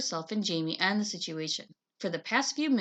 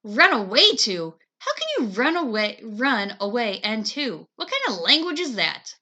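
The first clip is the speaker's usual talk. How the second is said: mild scolding; 7 dB louder than usual. Acted. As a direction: turn on him over grammar. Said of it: "Claudia turned on him".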